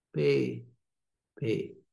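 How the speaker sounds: ordinary talk, nothing marked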